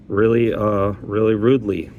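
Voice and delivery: normal talking voice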